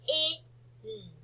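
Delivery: K-I-N as three separate letter sounds; phonics-style K... I... N; sounded out. Shown as a demonstration. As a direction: sound out K-I-N, a gap after each sound. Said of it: "I heard an I".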